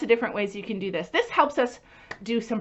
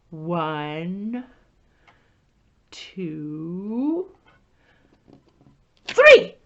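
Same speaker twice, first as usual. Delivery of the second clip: building count; 4 semitones lower, 3 dB louder, much slower than usual. Acted. Teacher counting with children before the reveal, building up. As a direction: build the suspense to the big three.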